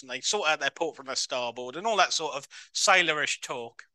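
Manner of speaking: ordinary talk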